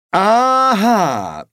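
long drawn-out, high, loud "aha"; sarcastic. A narrator's reading. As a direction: draw it out with mock satisfaction. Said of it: "sagte Silberfisch sarkastisch".

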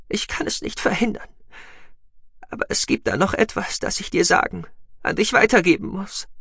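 put-on high, fragile young woman near death; urgent but failing strength; dying young woman. Voices Sahira, dying.

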